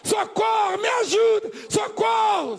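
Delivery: high shouted cries of socorro; mock cry for help. Preacher acting a stranger screaming for help, shouted. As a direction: yell for help as if in danger. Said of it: "Se você for passando alguém".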